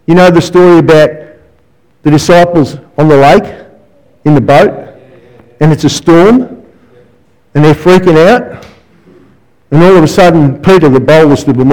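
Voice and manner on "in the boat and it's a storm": normal talking voice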